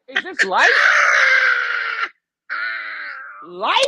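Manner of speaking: high, loud mock-dramatic cry; incredulous shout. Man re-enacts watching her wake up, incredulous shout. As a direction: cry it out in theatrical disbelief. Acting out disbelief at the scene.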